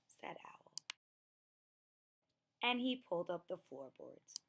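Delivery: ordinary delivery, nothing marked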